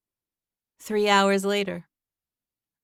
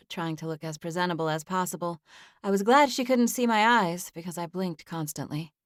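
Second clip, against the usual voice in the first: usual speaking voice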